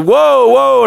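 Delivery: high-pitched retold alarm; startled whoa. Man nearly walking into the visitor, startled. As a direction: yelp it as if pulling up short.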